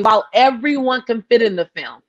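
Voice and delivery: normal talking voice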